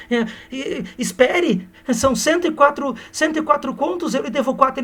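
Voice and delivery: high, quick-witted surprised count; mock flustered lady. Aurélia realizing she owes Seixas, high flustered. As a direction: count it out, caught off guard.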